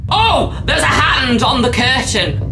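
high startled cry; alarmed shout. Real, not acted. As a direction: call out the shock. Hand appears on curtain.

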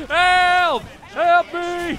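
high, loud panicked cry; shouting for help. Real, not acted. Caught by zombie in game.

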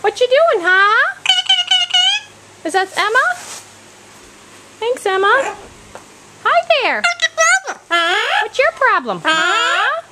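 put-on high sing-song baby talk; reading to a toddler. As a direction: coo at a toddler. Adult talks to toddler with toy animals.

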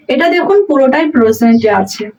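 usual speaking voice

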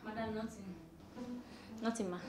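meek denial. Acted. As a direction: shrink down after being caught by the madam. Caught shouting, meekly denies it.

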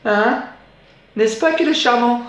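high-pitched, delighted fiancée; high coaxing lady voice; fiancée's voice. Acted. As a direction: gush in a high put-on voice. Fiancée voiced showing off dog.

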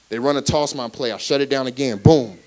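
ordinary delivery

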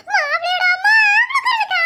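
can barely get it out for laughing; cracking up while gossiping to her friend; laughing through words. Real, not acted. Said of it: "ஒழுங்கா சிரிக்காம பேசி".

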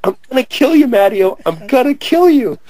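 high-pitched, worked-up threat; retold mock threat. Chasing Matteo all over the place, high mock-furious threat.

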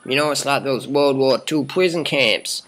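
ordinary delivery

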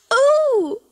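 very high, loud "oh"; startled cry. A narrator's reading. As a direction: shriek it in sudden alarm. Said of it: "cried Missus Pitterpatter".

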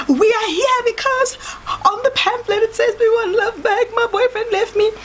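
very high, squeaky nasal English; high-pitched nasal voice putting on proper English; English through the nose. Retold or imitated. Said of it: "English is coming out our noses".

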